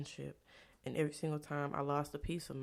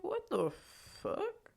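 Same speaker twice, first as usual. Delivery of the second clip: shrill disbelieving squeak, much higher; retold high-pitched shock at him leaving; shocked what the fuck; 13 semitones higher, slower than usual. Retelling his walking out.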